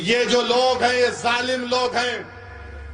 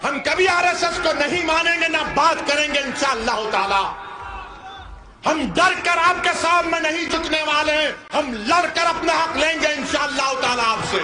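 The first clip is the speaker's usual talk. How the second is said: high-pitched, forceful stump speech; ringing political vow; defiant rally oratory; 6 semitones higher than usual. Real, not acted. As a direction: proclaim defiance to the crowd, each opening pushed high.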